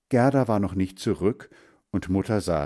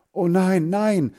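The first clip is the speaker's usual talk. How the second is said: high, louder pleading no; alarmed cry; 11 semitones higher, 5 dB louder, slower than usual. Acted. Boy begging his mother not to pour out his fish, alarmed cry. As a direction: cry out to stop her. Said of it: "rief Hans Frieder".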